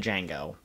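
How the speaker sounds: Django said JANG-go. Shown as a demonstration. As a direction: Django with the "a" of "jangle". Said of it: "I've always pronounced it Django".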